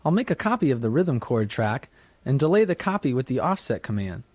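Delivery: ordinary talk, nothing marked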